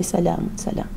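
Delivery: low, soft dismissive murmur; contemptuous. Acted. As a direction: brush him off with quiet disdain. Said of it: "فبص لي باحتقار كده وقال لي". (باحتقار وبصوت خافت)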